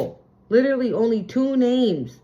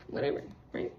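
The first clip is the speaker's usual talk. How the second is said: whispered aside; 12 dB quieter, faster than usual. Real, not acted. Dismissing the clock sign.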